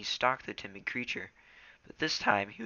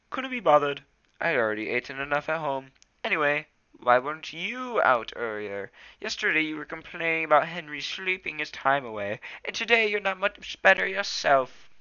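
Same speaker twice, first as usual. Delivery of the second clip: higher, louder cat-friend voice; Smudge the cat, teasing; 7 semitones higher, 7 dB louder than usual. Acted. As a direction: needle your friend in a raised voice.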